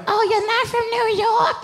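high, slow mock witch voice; witchy New Yorker. A Yankee quizzing the outsider, high mock witch voice. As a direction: cackle the question like a witch. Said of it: "sound like witches".

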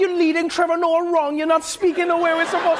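high, strongly Trinidadian mock scolding; high, hammed-up Trinidadian accent; Trini accent. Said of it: "They're like".

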